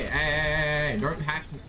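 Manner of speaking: ordinary talk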